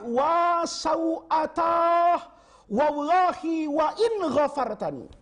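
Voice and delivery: slow, drawn-out anguished cry, pitched high. Retold or imitated. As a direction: wail it out stretched and ashamed. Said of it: "Sambil teriak".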